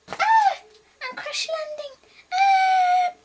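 high loud crash terror; screaming as the plane falls; terrified yells; panicked scream. Acted.

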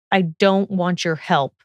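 usual speaking voice

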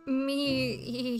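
long, quavering mock bleat; goat bleat. Mimics the Yule Goat's bleat.

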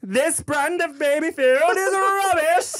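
slow, very high British whine; British-accented baby. Acted. Baby rejecting his food, high slow British accent. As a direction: pronounce judgement as a squeaky British baby. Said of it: "busted out with this British accent".

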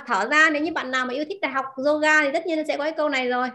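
usual speaking voice

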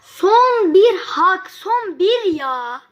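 very slow, leaning hard on "son"; dragged-out urgency. Real, not acted. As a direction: stretch every word of the last chance. Stressing the last chance left. (son şansı vurgulayan çok yavaş, uzatılmış söyleyiş)

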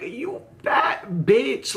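high, drawn-out mock outburst; outraged insult. Storyteller firing back at the insulting man, high outraged drawl. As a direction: stretch each word in high-pitched indignation.

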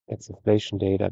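ordinary talk

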